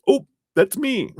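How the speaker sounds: high laughing "oh, that's me"; laughing realization. Real, not acted. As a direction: laugh it out as you catch yourself. Laughing at her own realization.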